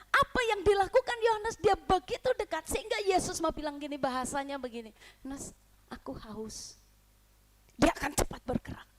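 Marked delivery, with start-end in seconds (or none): 5.3-6.8 quiet voice of Jesus; soft request. Acted. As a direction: say it softly, barely there. Jesus quietly asks John. (lembut dan pelan)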